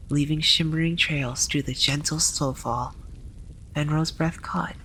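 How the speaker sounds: usual speaking voice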